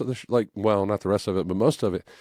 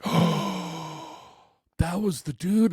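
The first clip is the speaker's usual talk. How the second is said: gasped realization; 12 semitones higher, much slower than usual. Retold or imitated. Sudden realization, gasp.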